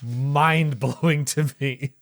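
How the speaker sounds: ordinary delivery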